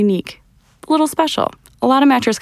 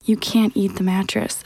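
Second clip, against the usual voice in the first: whisper; slower than usual. Acted. Hushed correction.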